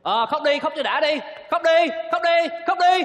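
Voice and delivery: growing angry yell of cry then; yelling at her. Acted. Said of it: "mày nạt mày la nó".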